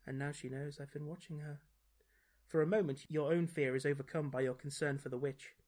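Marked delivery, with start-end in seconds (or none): 0.1-1.6 soft, private aside; as if to herself. A narrator's reading. Witch realising she is discovered, softly to herself. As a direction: say it under your breath. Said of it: "as if to herself".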